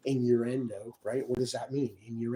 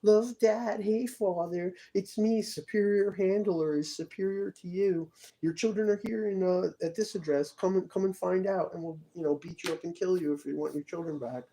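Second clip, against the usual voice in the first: much higher, sneering taunting voice; mocking imitation; 7 semitones higher, 4 dB louder than usual. Mimicking people who mock his videos, high taunting voice. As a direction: mimic tormentors mocking him, high and jeering. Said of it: "these people make fun of my videos".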